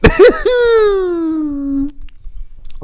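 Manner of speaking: long, very high squeal of laughter; high-pitched laugh. Real, not acted. Laughing at own muddled point, high squeal. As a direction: break into a high, drawn-out laugh.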